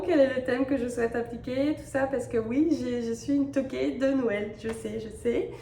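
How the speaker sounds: ordinary talk, nothing marked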